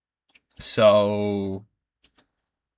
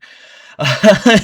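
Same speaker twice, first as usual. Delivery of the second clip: burst of laughter; 11 semitones higher, 15 dB louder than usual. Real, not acted. Laughing at the story.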